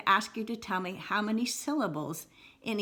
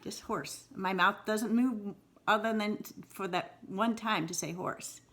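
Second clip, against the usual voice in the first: ordinary talk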